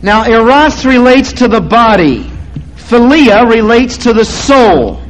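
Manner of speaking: full-voiced sermon delivery; preaching. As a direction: press the point to the congregation.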